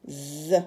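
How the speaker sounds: "Z" as a long buzzing zzz; letter sound of Z. Shown as a demonstration. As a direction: hold the buzz of "Z", not the letter name. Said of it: "le son ze".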